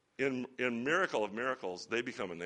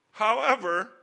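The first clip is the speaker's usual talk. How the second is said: emphatic however; 11 semitones higher, 9 dB louder than usual. Real, not acted. Preacher turning a point.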